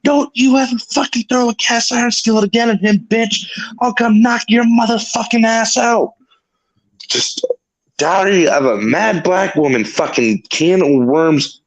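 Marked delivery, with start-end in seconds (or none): 0.0-6.1 much higher-pitched mock tirade; imitated angry yelling. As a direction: imitate a friend furiously threatening someone. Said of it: "she starts fucking yelling at Julie".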